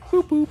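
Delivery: high, loud robotic boops; boop noises. Pretend. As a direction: chirp like a fake scanner. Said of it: "waving in the air and going".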